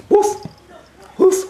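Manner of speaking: high, punchy pretend woofs; dog bark imitation. Person playing the dog to tease the cat, high barking.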